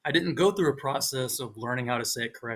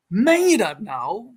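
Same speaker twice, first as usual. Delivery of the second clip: loud, high, drawn-out Dutch question; incredulous Dutch with native intonation, rising; 7 semitones higher, 8 dB louder, slower than usual. Retold or imitated. Learner mimicking native Dutch speakers, high incredulous Dutch intonation. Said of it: "your intonation is like a native speaker".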